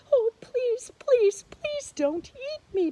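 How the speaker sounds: high, loud squeaky pleading; begging mouse. Acted. Mouse begging the lion not to eat him, high frantic plea. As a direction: beg for your life like a tiny mouse. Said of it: "begged the mouse".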